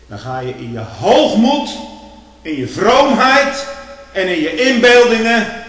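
preacher denouncing pride, full force; building rebuke. Acted. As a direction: climb into a ringing pulpit shout.

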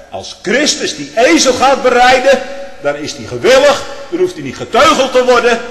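fiery preaching. Real, not acted. Preaching at full force.

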